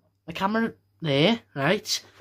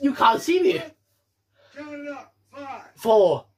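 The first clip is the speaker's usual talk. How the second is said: ordinary talk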